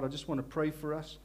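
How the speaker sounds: ordinary talk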